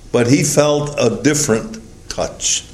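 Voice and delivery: ordinary talk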